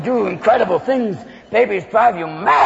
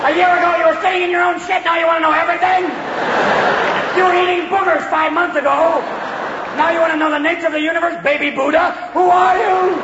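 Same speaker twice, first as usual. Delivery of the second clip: exasperated rant; 7 semitones higher than usual. Acted. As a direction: blow up at the pestering questions. Father snaps at child's endless questions.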